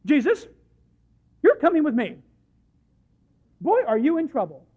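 put-on a parent scolding a naughty boy, loud; parental correction. Peter scolding Jesus like a parent, loud mock reprimand. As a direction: scold him like a caught child. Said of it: "the word in the Greek is a parental correction".

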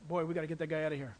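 low, fast scoffing aside; mocking crowd. Crowd grumbling about the fanatic, fast low scoff. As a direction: mutter it quickly, dismissive.

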